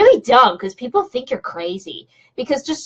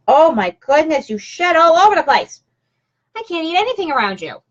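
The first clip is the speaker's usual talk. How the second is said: higher-pitched, exasperated complaint; exasperated dog scolding; 6 semitones higher than usual. Real, not acted. Owner to her shedding puppy, exasperated.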